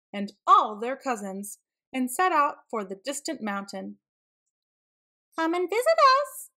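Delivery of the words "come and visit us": high, loud call-out; excited high-pitched shout. A narrator's reading. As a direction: call out high and fast to the family. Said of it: "they shouted".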